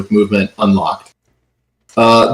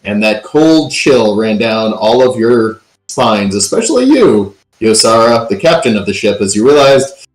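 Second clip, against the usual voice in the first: usual speaking voice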